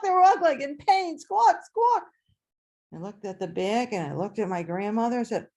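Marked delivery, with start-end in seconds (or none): 1.2-2.1 high, loud squawks; pained chicken squawk. Retold or imitated. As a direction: squawk like chickens being plucked.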